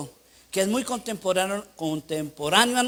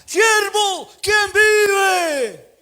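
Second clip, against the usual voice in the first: high, loud call across the street; shouted greeting; 10 semitones higher, 7 dB louder, much slower than usual. Retold or imitated. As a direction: yell it from across the road. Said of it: "me grita".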